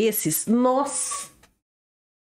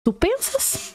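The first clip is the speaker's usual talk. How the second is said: mock squeal; 12 semitones higher, slower than usual. Mocking quote of the mother.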